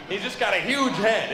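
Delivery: usual speaking voice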